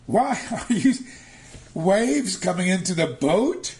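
high, loud, incredulous rebuke; rebuking. Acted. Yeshua scolding his fearful disciples, loud incredulous rebuke. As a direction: challenge the disciples' lack of faith. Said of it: "But he said to them".

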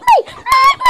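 very high squeak; squeaky toy voice. Pretend. Kid voicing toys, squeak.

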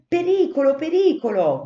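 very high, loud double warning cry; alarm shout. Acted. The sentinels seeing the man run out, a loud alarm. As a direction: scream the danger to the flock. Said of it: "gridarono a voce le sentinelle".

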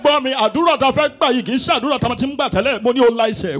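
high loud fast preacher command; shouting Yoruba pastor. Retold or imitated. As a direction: bark it like a fiery pastor.

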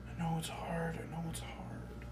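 soft whisper, soothing himself; whispered comfort. Acted. Man whispers to himself to calm his fear, soft whisper. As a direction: hush yourself through the panic.

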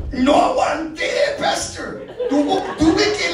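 bursting into loud laughter mid-story; can't hold the laugh in; loud laughing confession. Real, not acted.

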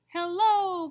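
loud, high call to the ducks. Acted. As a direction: hail them from the ship. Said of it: "calls the captain".

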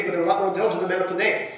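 racing fast through the sentence; rushing the words. Retold or imitated. Boy rushing his novel until the teacher says slowly, very fast. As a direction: gabble it, too eager to slow down. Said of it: "Slowly," Miss S says. "Read slowly".

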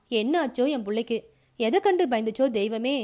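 ordinary delivery

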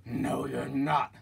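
slow, menacing troll. Acted. Troll refusing the little goat, slow menacing voice. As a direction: draw it out like a mean troll. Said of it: "said the troll".

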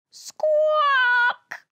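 long, high, loud squawk; piercing held squawk; imitated squawk. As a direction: screech it like an alarm bird. Story's horrible alarm noise imitated.

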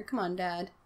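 normal talking voice

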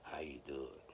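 awed whisper to God. Retold or imitated. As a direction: whisper it like a secret prayer. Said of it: "That's how I be talking".

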